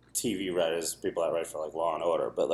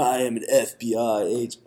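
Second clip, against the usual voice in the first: Keanu Reeves impression; 3 semitones higher than usual. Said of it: "say, if someone's Keanu Reeves".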